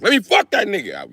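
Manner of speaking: put-on old man jumping at the chance; old guy. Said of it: "Some old guy like".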